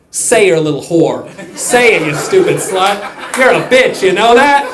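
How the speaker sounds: loud, jeering insults; mock bullying committee member; mock interrogation. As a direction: tear into her from the bench. Congress grilling the director.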